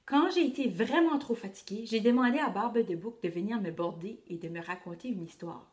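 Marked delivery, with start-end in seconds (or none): none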